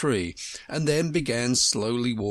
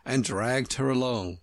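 ordinary delivery, nothing marked

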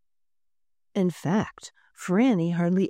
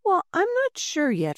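put-on much higher girlish voice; girl Franny; 11 semitones higher than usual. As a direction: shrug it off cheerfully. Franny answers the workers.

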